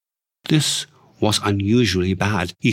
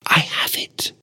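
triumphant whisper. Acted. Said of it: "she whispered triumphantly".

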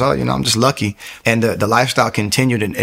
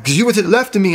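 panicked, much higher explanation; freaking out; 10 semitones higher, faster than usual. Retold or imitated. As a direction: rush it out, frightened and pitched up. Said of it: "I'm freaking out".

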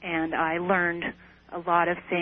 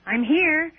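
high, loud eager greeting; eager call; 9 semitones higher, 5 dB louder than usual. Retold or imitated. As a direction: call out happily to the light. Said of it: "I said".

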